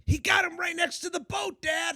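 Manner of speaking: high, loud imitation of his daughter; high-pitched retold kid's holler; excited daughter. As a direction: shout to Dad as an excited kid. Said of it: "And my daughter's like".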